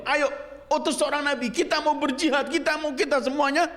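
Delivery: high-pitched eager urging; clamorous rallying cry. Acted. Israelites urging their prophet to send a leader for jihad, high clamour. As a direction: imitate a crowd clamouring for action, pitched high. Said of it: "teriak, mengajak".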